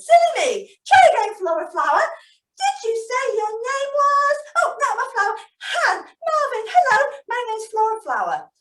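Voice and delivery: put-on squeaky, laughing flower girl; Flora Flower voice. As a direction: play a bubbly flower-shop girl. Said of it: "Flora speaks".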